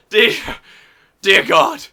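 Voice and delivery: high, loud dear God; exasperated cry. Acted. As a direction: cry it out in despair.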